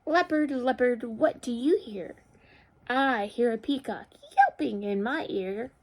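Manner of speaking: measured, expressive question and answer; picture-book read-aloud. A narrator's reading. Teacher reads animal story to children, expressive storytelling. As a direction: read it out clearly for small children.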